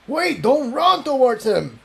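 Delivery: high, urgent call to stop her; alarmed shout. Acted. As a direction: shout a warning after her. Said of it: "Jigsaw shouted".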